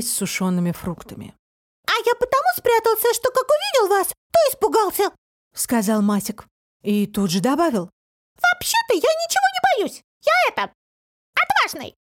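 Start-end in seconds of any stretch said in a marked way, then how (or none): 1.9-5.1 thin, piercing high voice; tiny creature squeaking an explanation; Масик. A narrator's reading. Little man explains his hiding to guests, high squeak. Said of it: "голосок тоненький и пронзительный". 8.4-12.0 thin, very high squeaky voice; Масик. A narrator's reading. Little man claims bravery to his guests, piercing squeak. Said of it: "голосок тоненький и пронзительный".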